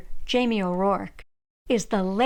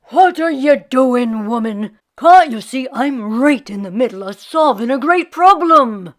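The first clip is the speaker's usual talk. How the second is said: grumbling husband; 9 dB louder than usual. Acted.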